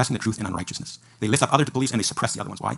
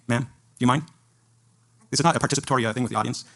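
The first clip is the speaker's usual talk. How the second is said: usual speaking voice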